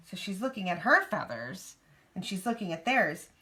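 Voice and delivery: usual speaking voice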